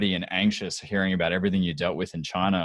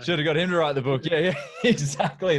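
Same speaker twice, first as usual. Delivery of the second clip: repeating the joke while laughing, higher; 7 semitones higher than usual. Real, not acted. Host echoing the guest's joke, laughing. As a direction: laugh through the words.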